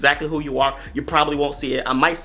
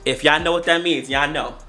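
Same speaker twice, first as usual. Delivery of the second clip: ordinary talk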